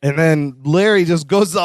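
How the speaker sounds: ordinary talk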